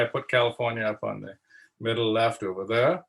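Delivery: ordinary talk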